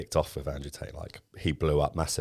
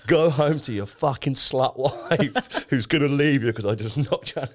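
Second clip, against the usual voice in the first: slow, high, louder mock taunt, laughing through; imitating Tate's taunt; 8 semitones higher, 4 dB louder, slower than usual. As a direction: gloat over a knocked-out fighter. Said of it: "he goes".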